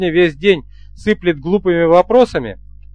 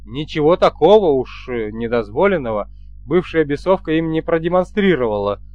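ordinary delivery

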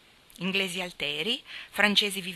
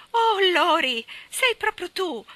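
high, loud joyful cry of greeting; excited exclamation; 9 semitones higher, 8 dB louder than usual. Acted. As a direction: call out to him, thrilled to see him. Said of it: "esclamò Amy".

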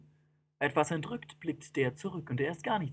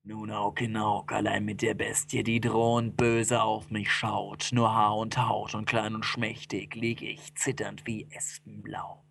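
low, slow storytelling; dread-laden, deep recital; ominous narration; 6 semitones lower than usual. A narrator's reading. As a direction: tell it slowly in a dark low register.

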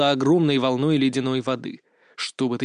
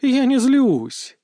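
drawn-out, pitch swooping up on the denial; exaggeratedly slow, seething denial; slow, each word stressed; 4 semitones higher, much slower than usual. A narrator's reading. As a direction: hammer out every word separately. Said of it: "медленно, выделяя каждое слово, отчеканил".

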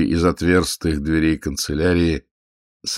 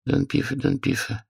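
droning mumble; 8 semitones higher, 5 dB quieter than usual. A narrator's reading. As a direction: mumble while reading a list. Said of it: "забубнил чиновник".

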